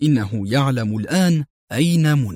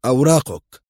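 terse official demand, mildly stern; a touch of official severity; 3 dB louder than usual. A narrator's reading. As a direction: demand it with understated authority. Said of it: "قائلا في صرامة".

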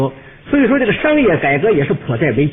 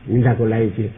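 much lower-pitched customer voice; polite customer; 10 semitones lower, 3 dB quieter than usual. Acted. Customer adding to a fruit order, low and polite. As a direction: drop low to place a modest order.

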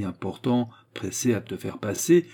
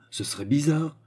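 ordinary delivery, nothing marked

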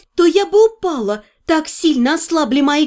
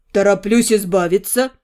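put-on low, easy young man's voice; a low voice for the fiancé; male lover voice; 6 semitones lower than usual.

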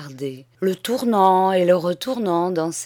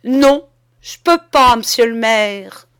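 loud, raised, unyielding no; firm refusal; 5 semitones higher, 10 dB louder than usual. A narrator's reading. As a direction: refuse firmly and forcefully. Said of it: "répondit avec fermeté".

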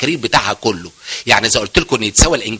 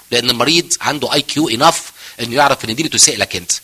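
ordinary talk, nothing marked

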